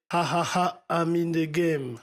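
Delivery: "Ha" repeated three times, then "I'm in the game" drawn out slowly; imitated stutter effect. Shown as a demonstration. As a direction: mimic a looped first syllable "Ha" before the phrase. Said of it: "trois bégaiements qui font". (effet de bégaiement imité sur « Ha »)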